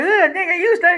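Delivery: very high, loud revolted squeal; mock disgust. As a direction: imitate a woman recoiling from a stink. Retells woman's reaction to smell.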